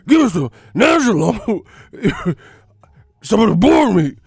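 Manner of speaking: loud, high crazed begging, breaking into laughs; Tyson Fury. Acted. Boxer demanding wild boar, loud manic high cries. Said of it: "That's how Tyson Fury be".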